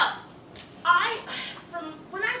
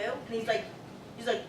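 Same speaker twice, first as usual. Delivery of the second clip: usual speaking voice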